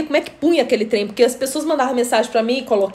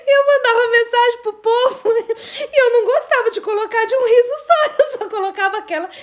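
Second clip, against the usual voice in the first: high, giggly storytelling; words riding on real laughter; laughing confession; 9 semitones higher than usual. Real, not acted. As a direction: confess it while cracking up. Laughing through own confession.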